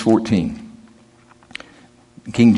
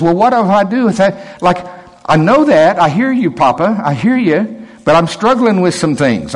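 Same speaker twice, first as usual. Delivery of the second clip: put-on high, pleading young man's voice, much higher; Tyler pleading; 7 semitones higher than usual. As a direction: plead like a struggling kid to his dad. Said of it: "Tyler asked the question".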